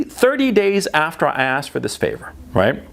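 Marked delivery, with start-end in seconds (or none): none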